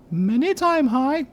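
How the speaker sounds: put-on very high, small girlish voice; girl of ten voice. Player voicing hooded Pox in a high girlish voice. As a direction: squeak it out like a ten-year-old girl. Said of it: "I'm doing a silly voice".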